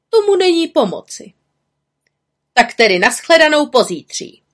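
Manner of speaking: high, loud storybook witch; emphatic high character voice; Bimbula's parting jibe. A narrator's reading. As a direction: pitch it up and throw it out loudly. Aunt Bimbula's parting remark.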